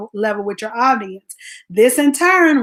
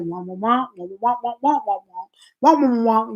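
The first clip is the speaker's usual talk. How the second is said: cartoon adult wah. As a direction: imitate the muffled cartoon grown-ups. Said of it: "all the adults was like".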